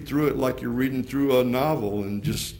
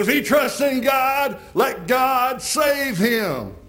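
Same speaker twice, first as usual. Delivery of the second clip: much higher and louder jeering shout; mocking shout; 12 semitones higher, 5 dB louder, slower than usual. Crowd mocking Jesus on the cross, jeering shout. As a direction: jeer at him with scorn. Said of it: "They were mocking him".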